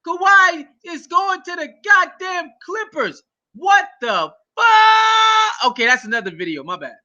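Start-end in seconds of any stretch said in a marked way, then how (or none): 0.1-5.7 real shocked shout. Real, not acted. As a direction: scream out the shock at the news. Still reeling from trade news.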